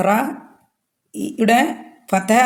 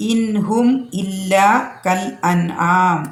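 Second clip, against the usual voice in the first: slow recitation with long held vowels; Quran recitation; much slower than usual. Real, not acted. Teacher reciting the verse to students, slow recitation. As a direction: recite it slowly, stretching each long vowel.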